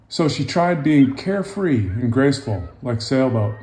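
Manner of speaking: lively picture-book narration; storybook read-aloud voice; reading to children. A narrator's reading. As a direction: tell it gently to young listeners.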